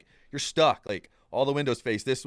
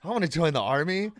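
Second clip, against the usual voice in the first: eager; 7 semitones higher, 5 dB louder than usual. Retold or imitated. Eager about the army offer.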